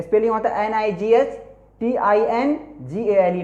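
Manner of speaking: ordinary talk, nothing marked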